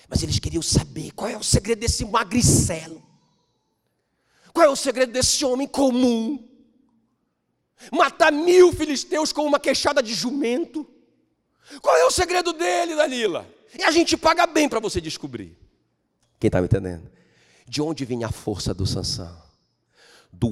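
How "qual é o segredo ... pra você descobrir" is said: high, loud demanding Philistine voice; Philistines bribing Delilah. Acted. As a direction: push Delilah hard for the secret.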